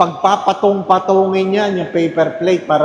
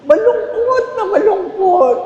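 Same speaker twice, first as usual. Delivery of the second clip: high, hammed-up sorrow; mock sad; 13 semitones higher than usual. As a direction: wail it in fake grief. Mock sadness of greedy guest.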